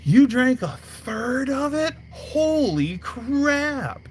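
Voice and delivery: stunned, stretch the holy crap; astonished. Retold or imitated. Teller hearing his buddy drank a third, shocked and slow. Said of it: "I'm like".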